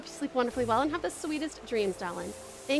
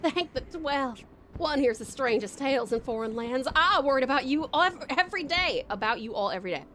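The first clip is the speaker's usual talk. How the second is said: Southern Belle voice; 2 semitones higher than usual. Acted. Welcoming returning friend in a light Southern Belle accent. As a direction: greet them like a doting Southern lady. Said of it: "gave her a Southern Belle voice".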